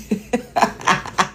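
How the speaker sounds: soft staccato laugh; amused chuckle trailing out. Real, not acted. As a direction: giggle in a quick series of bursts. Amused by the viewers' messages.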